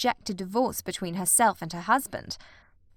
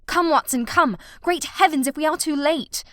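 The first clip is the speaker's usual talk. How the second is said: loud, higher, commanding; urgent shout; 5 semitones higher, 5 dB louder than usual. A narrator's reading. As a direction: urge him on in alarm. Running to save Sir Henry.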